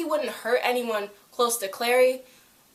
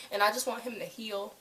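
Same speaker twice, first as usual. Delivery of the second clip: ordinary talk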